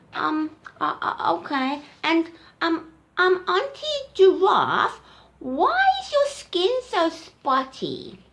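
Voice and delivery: high, slow hesitant calf voice; little elephant voice. Acted. Little elephant hesitantly asking Auntie Giraffe, high and slow. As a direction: stumble shyly into the question.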